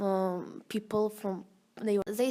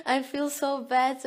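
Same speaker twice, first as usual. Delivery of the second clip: retelling a panicked plea, pitched higher; 5 semitones higher, 6 dB louder than usual. Retold or imitated. Student caught in tear gas calls her friend, retold panicked plea. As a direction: relive the scared call to a friend, voice up. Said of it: "asked her".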